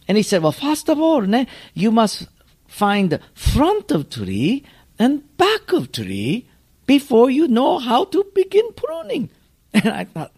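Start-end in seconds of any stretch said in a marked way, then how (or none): 0.4-9.3 put-on much higher, slow Japanese-accented master; higher, patient Japanese-accented teacher explaining; Mister Miyagi voice. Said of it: "so we'll just call him Mister Miyagi".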